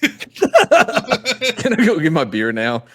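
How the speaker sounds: put-on slow New Zealand accent drifting toward Mexican and Canadian; drawn-out New Zealand accent, half-melted; New Zealand accent that slips into others; mixed New Zealand accent. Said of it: "sound like a Mexican accent mixed with Canadian".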